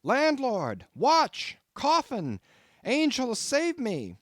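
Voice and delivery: crying out for the landlord in the dark; terrified shout. A narrator's reading. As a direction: scream for help in terror. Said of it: "shouted I".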